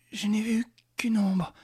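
breathless whisper; whisper, spent and gasping; out of breath whisper. Acted.

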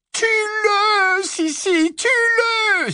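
very high, loud shrieking command; high, frenzied witch scream; screamed order. A narrator's reading. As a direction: shriek at her sister to kill. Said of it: "s'écria Bellatrix".

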